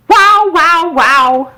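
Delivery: high, loud howling wows; howling chorus. A narrator's reading. Cook and baby joining the chorus, loud howls. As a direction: yowl the chorus. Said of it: "the cook and the baby joined".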